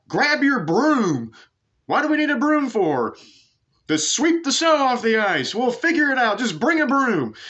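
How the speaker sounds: very high, loud hollering back and forth; hammed-up high-pitched group hollering; mock drunken yelling. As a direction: shout it like rowdy drunks inventing a game. Drunk Scots calling out to each other.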